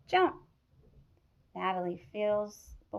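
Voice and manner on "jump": excited shout. Acted. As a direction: jump called out high and loud. Counting down to jump.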